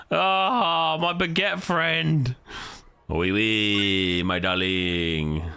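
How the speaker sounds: hammed-up French accent, drawn out slowly; a playful fake French greeting; lightly put-on French accent; mock French accent. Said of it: "I put on the accent".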